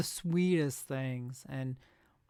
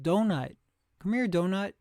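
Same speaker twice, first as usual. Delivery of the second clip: a dog owner calling across the park, louder and higher; 4 semitones higher, 6 dB louder than usual. Retold or imitated. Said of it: "I hear somebody yell out".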